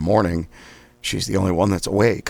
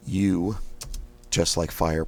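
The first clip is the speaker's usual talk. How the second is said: ordinary talk, nothing marked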